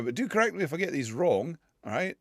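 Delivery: usual speaking voice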